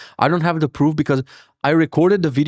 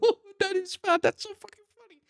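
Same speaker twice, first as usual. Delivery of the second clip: put-on very high, softer tiny-kid voice; high, quiet child delighted at dad; little daughter laughing; 6 dB quieter than usual. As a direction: giggle it as a small child would.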